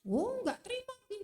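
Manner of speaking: high mock protest; high, indignant inner voice; voice of feelings. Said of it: "Perasaan kita ngomong".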